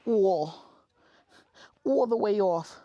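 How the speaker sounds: put-on high, loud Rosie Perez accent; big, high-pitched impression with a Rosie Perez accent. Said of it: "Rosie Perez".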